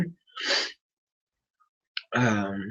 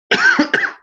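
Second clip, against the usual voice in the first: loud, stretched-out yawn; big yawn; 4 dB louder than usual. Real, not acted. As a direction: let out a huge yawn. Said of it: "best yawn in the world".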